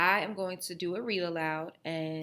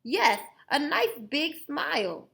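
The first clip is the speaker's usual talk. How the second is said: Grandpa's toothless voice; 8 semitones higher, 6 dB louder than usual. Acted. Grandpa asking.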